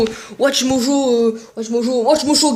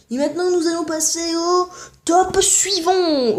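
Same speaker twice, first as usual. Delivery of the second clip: slow, drawn-out, higher announcer voice; mock announcer; 5 semitones higher, slower than usual. Host introducing the next top, mock announcer drawl. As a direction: stretch it into a grand fake announcement.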